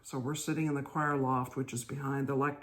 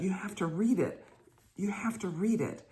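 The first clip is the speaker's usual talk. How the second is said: higher, pressing insistence; a louder stage whisper, insisting; 5 semitones higher than usual. Retold or imitated. Rector pressing the reader, getting louder, higher in pitch. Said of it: "gets louder".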